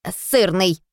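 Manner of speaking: lightly voiced robot; a hint of robot voice; robot verdict. A narrator's reading. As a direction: announce it like a machine. Robot character delivers its verdict.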